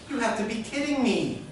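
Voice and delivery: high-pitched disbelief; incredulous. Retold or imitated. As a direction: react in mock outrage. Customer reacts to the refusal.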